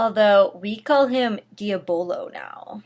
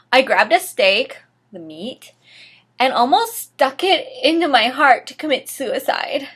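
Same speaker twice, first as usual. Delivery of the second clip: ordinary delivery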